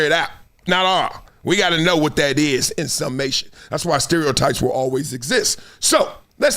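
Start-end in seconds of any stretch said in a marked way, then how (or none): none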